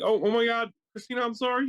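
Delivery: high-pitched, flustered retold apology; mock panicked apology. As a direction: rush to soothe her in an exaggerated high voice. Husband apologises to crying wife.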